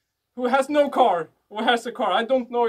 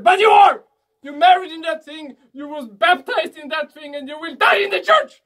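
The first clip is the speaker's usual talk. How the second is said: loud, high comic shout, staying raised to the end; emphatic shout; 4 semitones higher, 6 dB louder than usual. Real, not acted. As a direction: yell it at the audience, mock outrage. Comic rant at the sosse.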